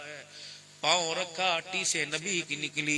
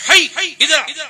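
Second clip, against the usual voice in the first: a sharp, higher, louder summons; angry call; 12 semitones higher, 15 dB louder than usual. Shown as a demonstration. Father angrily summoning a son who won't come, sharp and loud. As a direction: bark it like a fed-up father. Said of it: "یہ غصے کا لفظ ہے".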